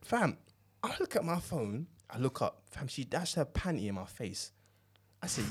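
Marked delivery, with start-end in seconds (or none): none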